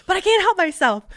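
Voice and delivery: retold helpless exclamation, voice shooting up; helpless outburst. Recruit explaining why she kept greeting the man, helpless outburst. Acting out compulsive greeting of stranger.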